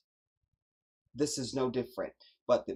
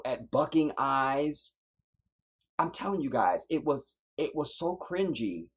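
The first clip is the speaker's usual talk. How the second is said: usual speaking voice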